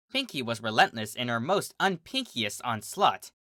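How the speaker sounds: ordinary delivery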